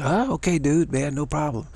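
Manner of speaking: put-on carefree skater kid, no worries at all; laid-back kid. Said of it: "And he says".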